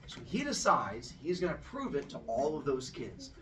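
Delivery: usual speaking voice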